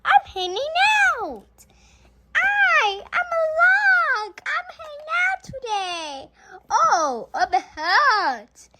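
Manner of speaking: high, loud, cheery toy voice. Pretend. A child playing her toys, high and cheery. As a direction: play a tiny happy toy character.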